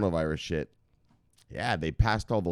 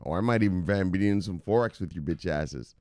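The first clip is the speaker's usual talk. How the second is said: usual speaking voice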